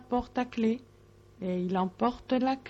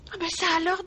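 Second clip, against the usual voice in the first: surprised little mouse; 8 semitones higher, much faster than usual. A narrator's reading. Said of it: "dit Sourisette".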